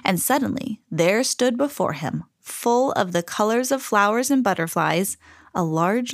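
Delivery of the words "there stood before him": ordinary delivery, nothing marked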